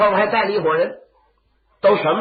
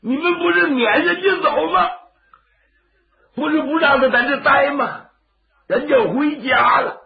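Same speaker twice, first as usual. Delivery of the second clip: angry rebuke; 9 semitones higher, 3 dB louder than usual. Acted. Old man rebukes his sons.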